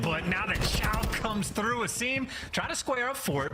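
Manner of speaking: rising esports commentary; excited play-by-play. Real, not acted. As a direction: voice climbing high as the push arrives. Live play-by-play of a push.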